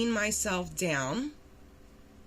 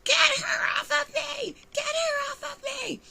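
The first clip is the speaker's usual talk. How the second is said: retold scream. The other woman on the stairs, imitating her high scream. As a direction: shriek it like someone being dragged. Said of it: "she's screaming".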